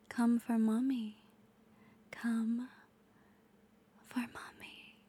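hushed close-mic whisper; intimate whisper. Acted. Intimate ASMR roleplay whisper.

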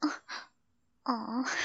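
ordinary delivery

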